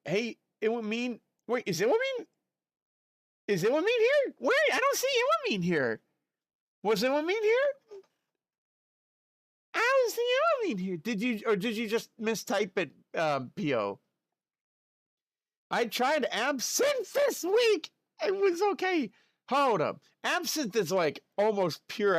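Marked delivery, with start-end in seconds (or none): 1.5-11.0 high squeaky puzzled rereading; mock-baffled high voice; confused falsetto. 15.7-19.1 high reading voice. Pretend. Reads chat in put-on voice.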